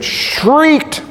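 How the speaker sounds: high-pitched demonstrative shriek on the word. Shown as a demonstration. Preacher tells of Stephen's killers, shrieking the word. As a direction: make the word itself a shriek.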